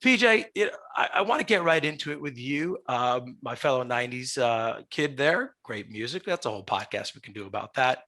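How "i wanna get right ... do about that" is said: normal talking voice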